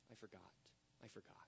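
very low, hushed admission; quiet confession. Acted. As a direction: drop to a barely audible undertone. Repeated confession, nearly inaudible.

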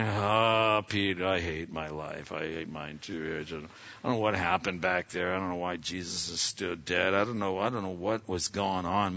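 low, soft, dejected morning grumble; glum disciple. Acted. Disciples sitting there early after Jesus died, glum. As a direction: sigh it out, hammed-up despondent.

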